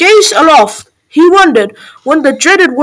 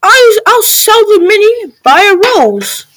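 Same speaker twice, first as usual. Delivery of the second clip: high, astonished mother crying out; excited yell; 7 semitones higher than usual. A narrator's reading. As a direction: yell out in delighted amazement, pitched high. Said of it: "She yells out".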